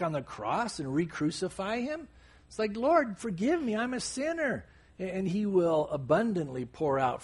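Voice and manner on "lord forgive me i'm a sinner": higher-pitched acted plea; lightly acted confession, pitched up; calling out. Sinner calling on the Lord for forgiveness, pleading. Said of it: "You call out to him".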